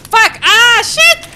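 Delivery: loud, high shrieked swearing; startled screaming. Real, not acted. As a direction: scream curses at a sudden fright. Jump scare interrupts reading.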